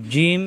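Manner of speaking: জিম with mid-tongue pressed to palate; drawn-out teaching জিম, tongue against the palate. Shown as a demonstration. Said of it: "জিহ্বার মাঝখান উপরে তালুতে চাপ দিয়ে".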